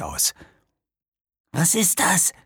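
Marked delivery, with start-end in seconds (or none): 1.5-2.3 high, voiced anxious question; anxious whisper. A narrator's reading. Jasper points at the thing in the dark, anxious. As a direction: ask it fearfully in a raised pitch. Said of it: "flüsterte Jasper ängstlich".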